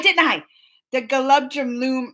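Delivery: ordinary talk, nothing marked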